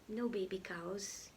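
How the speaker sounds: whispered aside. Real, not acted. Reading to kids, she whispers an aside.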